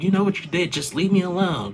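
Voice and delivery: high, loud mock yell repeated; wife yelling at her husband to leave her alone; retold scream. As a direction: fling it back at him, shouting. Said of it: "She was screaming at him".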